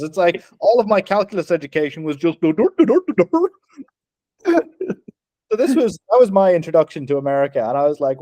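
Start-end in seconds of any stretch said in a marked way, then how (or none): 2.4-3.5 high, silly "do-do-do" babble; rising nonsense syllables, pitched way up; mock caveman scribbling noises. Student mimicking cave-wall calculus, silly scribbling noises.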